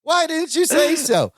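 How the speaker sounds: very high, drawn-out mimicry; exaggerated agreeable exclamation, slow and high; delighted turnaround. Hank learns Larry is Balki's friend, high delighted reply. Acting out Hank's friendly change of heart.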